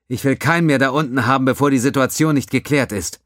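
brief the lieutenant with authority; captain voice. A narrator's reading. Captain Katami gives orders.

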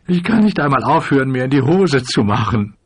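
high, wailing lament; acted crying complaint; tearful. A narrator's reading. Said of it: "Er fing an zu weinen".

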